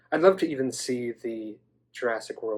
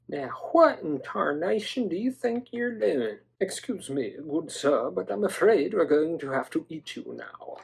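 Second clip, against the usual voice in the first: 1 polite southern villain; 4 semitones higher than usual. Pretend. Raptor voiced addressing other toy.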